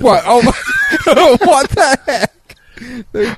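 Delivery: high, laughing slow what the heck; laughing disbelief. Real, not acted. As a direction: cry out through laughter. Laughing outburst at James.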